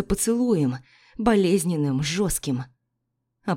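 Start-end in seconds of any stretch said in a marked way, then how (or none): none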